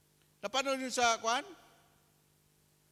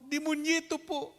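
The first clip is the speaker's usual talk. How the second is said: put-on higher little kid answer; small boy, sweetly naive; boy giving the priest his own name, higher child voice; innocent child voice; 9 semitones higher, 3 dB louder than usual.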